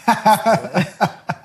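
helpless laugh mid-story; real laughter. Real, not acted. Guest telling of his knee insurance, laughing. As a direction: break off and laugh at yourself.

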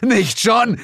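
high, loud shout; Bill shouts to stop his friend; reporter shouting. Acted. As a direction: yell it to stop him. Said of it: "schrie der Reporter".

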